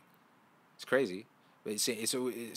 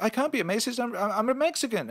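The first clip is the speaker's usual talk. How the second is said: high, loud mock-defensive retort; mock protest; 12 semitones higher, 4 dB louder than usual. Acted retort to racism accusation.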